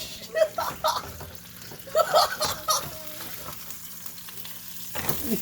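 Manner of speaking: long breathless wheeze laugh; hysterical laugh. Real, not acted. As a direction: lose it completely. Laughing at the show.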